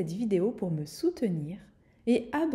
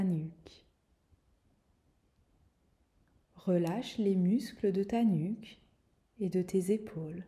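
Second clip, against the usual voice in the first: guided meditation; 3 dB quieter than usual. Acted. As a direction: guide her gently into calm. Leads a guided relaxation.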